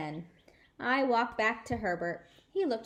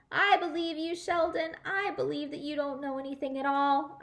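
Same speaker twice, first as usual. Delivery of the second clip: higher and louder playful shout; 6 semitones higher, 3 dB louder than usual. Acted. Junie B shouting to Sheldon, teasing loud call. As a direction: call it through cupped hands, teasing. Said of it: "I shouted".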